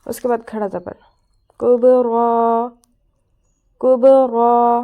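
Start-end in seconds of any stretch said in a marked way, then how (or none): 1.6-4.8 کبرا drawn out very slowly and loudly, with a heavy ر; slow Quran recitation. Shown as a demonstration. Teacher showing students the heavy ر, slow and loud recitation. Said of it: "را کو موٹا پڑا".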